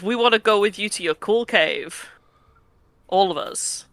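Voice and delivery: ordinary delivery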